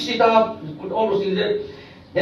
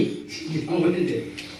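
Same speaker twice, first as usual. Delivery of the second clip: soft laugh; 8 semitones lower, 9 dB quieter than usual. Real, not acted. Crowd joins, he laughs.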